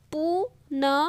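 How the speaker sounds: पुनर्जन्म letter by letter, "pu-na-ra-ja-na-ma"; evenly separated syllables in पुनर्जन्म; sounded out. Shown as a demonstration. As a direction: spell out पुनर्जन्म with a gap after each sound. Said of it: "र के बाद क्या आ रहा है".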